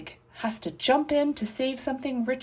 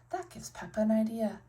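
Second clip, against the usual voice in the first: usual speaking voice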